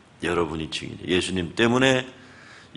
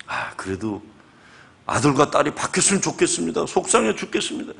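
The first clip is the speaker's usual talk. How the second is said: higher, whining complaint of a frustrated dad; upset father; 6 semitones higher than usual. Retold or imitated. Father complaining to the pastor about his son, whiny. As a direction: plead your disappointment in a higher voice.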